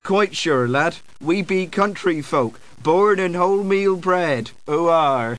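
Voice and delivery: put-on country-folk burr; feeble yokel accent. Wizard assures the lad they are country folk, yokel accent. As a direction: insist in a fake rustic farmer accent. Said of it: "You have absolutely feeble accents".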